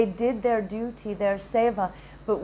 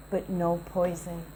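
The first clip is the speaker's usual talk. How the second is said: softer and lower, gently reassuring; soft gentle guru; 6 semitones lower, 8 dB quieter than usual. Retold or imitated. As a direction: ease her anger with a kind, hushed word. Guru gently reassures angry disciple.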